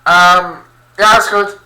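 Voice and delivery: loud, high shouted reply; calling back. Real, not acted. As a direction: holler it through to the next room. Answering someone calling from another room.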